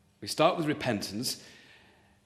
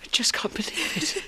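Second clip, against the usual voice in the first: stunned whisper; 9 semitones higher than usual. Acted. Stunned by pregnancy news.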